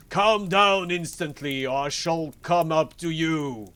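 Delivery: loud, high, slow-starting bellow; bawling. A narrator's reading. Bluebeard threatening his wife from below, loud bawling. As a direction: bawl the order up the house. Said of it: "as loud as he could bawl".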